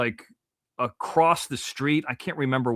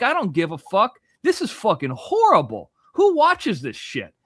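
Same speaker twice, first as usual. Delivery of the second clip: angry rant; 6 semitones higher than usual. Retold or imitated. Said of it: "And I'm like".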